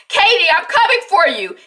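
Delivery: high, loud acted holler; calling out. Jack calling to Katie through fire, urgent holler. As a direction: imitate shouting to someone in another room. Voices dad calling to daughter.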